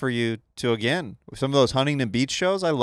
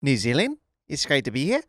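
New Zealand accent with questioning upswings; put-on Kiwi accent. Shown as a demonstration. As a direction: do a New Zealand accent, every phrase rising. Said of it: "your best New Zealand accent".

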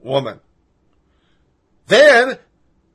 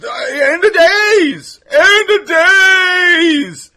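wailing end of days, high and stretched out; hammed-up apocalyptic wail; mock doomsday wail; 12 semitones higher, 5 dB louder, much slower than usual. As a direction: cry out like the world is ending.

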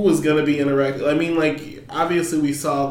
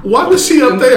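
higher, louder outraged question; indignant mock complaint; 8 semitones higher, 8 dB louder than usual. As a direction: demand it with disbelief. Voicing the fighters' complaint.

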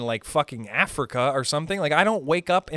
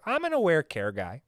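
usual speaking voice

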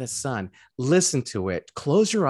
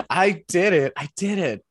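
mock hero line; 5 semitones higher, 5 dB louder than usual. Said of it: "co-host repeats the mocked line".

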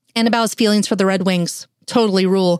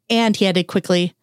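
plain reading voice

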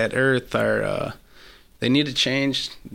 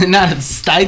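laughing mock; 6 semitones higher, 5 dB louder than usual. Mocking repeat, laughing.

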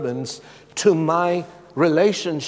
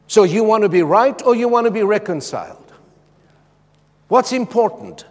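raised, high preaching challenge; forceful challenge; 6 semitones higher, 6 dB louder than usual. Real, not acted. Preacher challenging the congregation, raised and forceful. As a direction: press the congregation hard for an answer.

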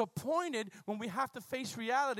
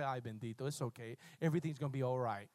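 lower, soft pretend coddling; a hint of mock pity; mock comforting; 9 semitones lower, 4 dB quieter than usual. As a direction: coo it like a soft-hearted aunt. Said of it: "He didn't say".

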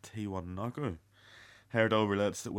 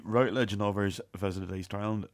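ordinary delivery

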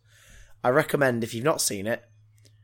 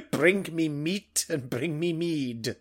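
barbarian; 3 semitones higher, slower than usual. Pretend. Pretend barbarian demands of wizard.

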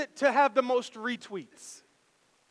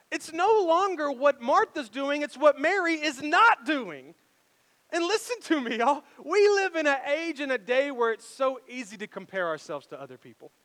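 normal talking voice

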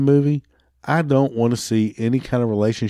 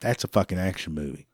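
ordinary talk, nothing marked

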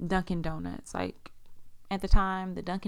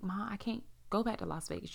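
whispered, pleading with mom; 8 dB quieter than usual. Retold or imitated. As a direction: hush it, pleading not to move. Daughter refusing mom, hushed.

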